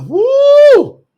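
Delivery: high, sustained mock whoop; giddy woo. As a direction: let out her giddy "woo". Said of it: "She was wondering, wasn't she?".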